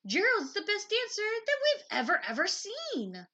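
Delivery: loud, high amazed cheer; excited shouting. Acted. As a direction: marvel out loud together. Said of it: "They shouted".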